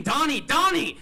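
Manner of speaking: very high, loud panicked scream; terrified yell of Donnie; screaming his name. Retold or imitated. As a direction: scream for him to wake up. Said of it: "and I started screaming".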